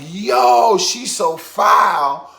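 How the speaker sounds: high, loud, drawn-out shout; scandalized exclamation. Retold or imitated. Telling his friend about the cheating girl, scandalized shout. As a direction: cry out in scandalized amazement.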